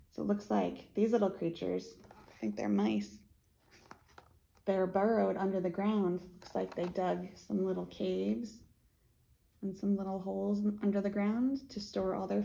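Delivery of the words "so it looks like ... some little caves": usual speaking voice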